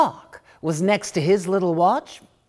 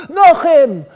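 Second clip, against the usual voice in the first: high, loud furious tirade, slowing at the end; loud, high outraged prophet's denunciation; angered prophet; 10 semitones higher, 10 dB louder than usual. Acted. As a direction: thunder it like an angry prophet. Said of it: "hurled the words like an angered prophet".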